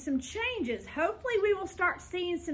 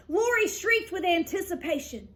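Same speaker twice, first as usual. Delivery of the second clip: ordinary delivery, nothing marked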